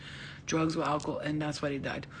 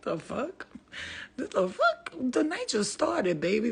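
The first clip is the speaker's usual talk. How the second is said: very high, slow, drawn-out protest; squeaky disbelief; 8 semitones higher, slower than usual. Retold or imitated. Telling friends the night just started, high and drawn out. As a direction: shriek it, then stretch it out. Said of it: "I'm like".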